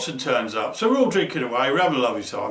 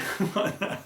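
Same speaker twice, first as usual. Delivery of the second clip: breathless laugh; 6 semitones higher, 6 dB quieter than usual. Real, not acted. Laughing after the shout.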